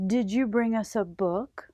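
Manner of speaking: plain reading voice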